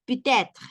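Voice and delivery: peut-être with the T linked into être, stressed; liaison in peut-être. Shown as a demonstration. Teacher drilling peut-être for Spanish speakers. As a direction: run peut-être together, T carried over. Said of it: "y lo pronunciamos".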